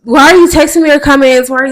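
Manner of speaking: ordinary delivery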